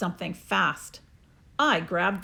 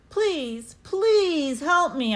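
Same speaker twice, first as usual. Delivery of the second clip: put-on boy pleading; 6 semitones higher, 3 dB louder, much slower than usual. A narrator's reading. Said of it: "I said".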